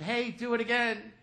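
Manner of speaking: put-on boy asking the pilot to do the bump again; eager little boy. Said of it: "He said".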